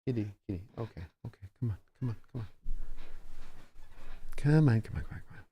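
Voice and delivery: hushed, soft coaxing to a cat; coaxing softly. Real, not acted. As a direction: coax the kitty quietly, barely voiced. Coaxing the cat between plays.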